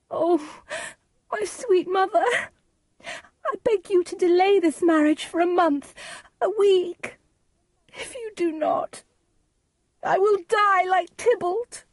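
high-pitched, desperate begging; very high, anguished daughter; Juliet. A narrator's reading. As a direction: plead with a mother in a high wail. Juliet begs her mother.

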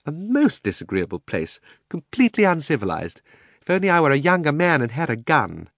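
affronted uncle working himself up; fussy uncle, indignant. Acted. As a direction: grumble indignantly, pitch climbing as it goes.

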